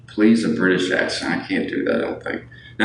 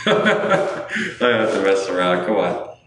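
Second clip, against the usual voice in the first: laughing; 2 semitones higher than usual. Real, not acted. Laughing at his own bit.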